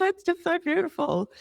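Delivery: high, teary-happy mock gush; gushing self-imitation. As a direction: play herself swept away by the music. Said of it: "And I'm like".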